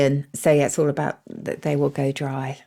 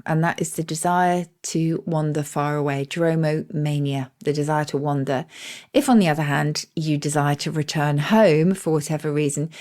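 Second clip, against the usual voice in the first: usual speaking voice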